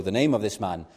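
ordinary delivery

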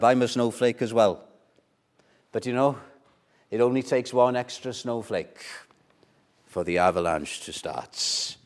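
usual speaking voice

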